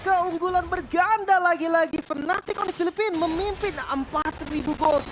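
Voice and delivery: high, loud, slow hype shout; fighting game announcer. Real, not acted. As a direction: belt out the lead like a finale. Co-caster hypes lead.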